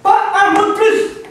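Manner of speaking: husband silences his friend; sharp command. Real, not acted. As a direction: cut him off firmly. Stopping the friend.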